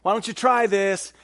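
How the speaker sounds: loud, breezy, offhand tone; mock casual suggestion. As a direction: play it like a friendly shout-out. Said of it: "He's not saying".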